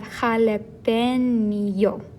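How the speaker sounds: "jalapeño" very slowly, syllable by syllable, lingering on nyo; drawn-out ñ. Shown as a demonstration. Teacher breaking down the example for learners, slow "jalapeño" with long ñ. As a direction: stretch out the ny in "jalapeño". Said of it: "gives us that ñ".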